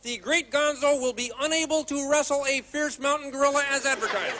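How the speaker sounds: show announcer. Acted. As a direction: announce the act to a full house. Announces the act to the audience.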